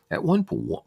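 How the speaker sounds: usual speaking voice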